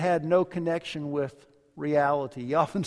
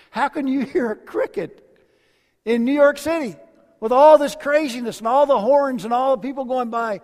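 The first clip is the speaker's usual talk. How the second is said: incredulous question; 6 semitones higher, 7 dB louder than usual. Retold or imitated. Friend asks in disbelief.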